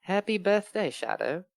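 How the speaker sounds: lightly voiced robot; a hint of a robot greeting; robotic voice. A narrator's reading. Reading Omega's birthday greeting to Shadow, lightly robotic. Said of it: "Came the robotic voice".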